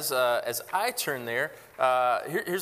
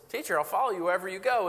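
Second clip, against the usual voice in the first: higher, fast eager vow; eager scribe; 5 semitones higher, faster than usual. Retold or imitated. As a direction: gush it like a keen new follower. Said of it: "You know, he says".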